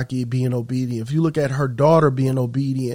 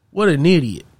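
mock disbelief, a little raised; retold boss's exasperated scorn; scornful boss; 3 semitones higher, 4 dB louder than usual. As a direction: scoff at her like a contemptuous boss. Boss scorns the believing woman.

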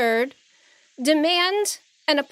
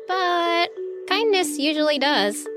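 high, lilting kindly line; gentle moral; 10 semitones higher than usual. A narrator's reading. Heroine teaching the defeated king kindness, gentle.

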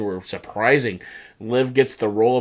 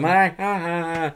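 high mock laugh; high "ha ha" cackle, trailing softer; imitated cackle; 5 semitones higher, 3 dB quieter than usual. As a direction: imitate a wrestler's showy laugh. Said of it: "Seth's laughing".

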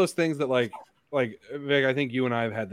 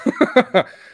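burst of laughter; 11 dB louder than usual. Real, not acted. Laughs at own eloquence claim.

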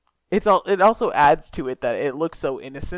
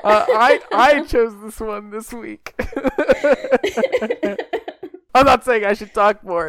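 high and loud, laughing through it; 8 semitones higher, 10 dB louder than usual. Real, not acted. Host laughs about picking this week's movie. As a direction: giggle out the confession.

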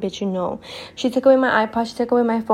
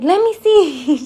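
high, louder insistent demand; imitating mom; 8 semitones higher, 5 dB louder, slower than usual. Mom demands to see hidden tattoo, high imitated insistence. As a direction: mimic mom ordering her child.

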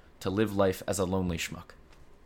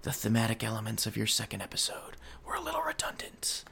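unvoiced critic's whisper; 9 dB quieter than usual. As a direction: hiss the critique like a phantom reviewer. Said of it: "I'll just hear whispers from the mic".

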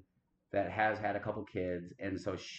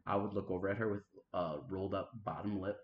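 normal talking voice

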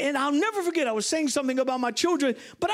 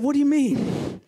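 higher, indignant retold challenge; taken aback; 3 semitones higher, 4 dB louder than usual. Preacher challenges his friend's wife over her correction, acted indignation. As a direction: push back as if baffled by a correction.

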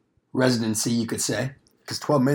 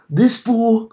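indignant; 10 semitones higher, 6 dB louder than usual. Real, not acted. Ranting about Polar Express kid.

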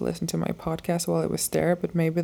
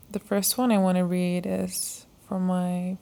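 ordinary talk, nothing marked

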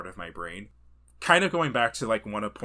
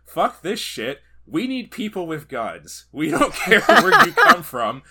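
loud, slower, laughing French officer; mock French shout; 9 semitones higher, 13 dB louder than usual. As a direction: bellow it like a fed-up French commander. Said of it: "and said".

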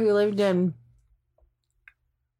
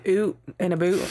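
Scottish "Ooh, in a boot"; Scottish accent. Retold or imitated. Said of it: "you can tell when she says".

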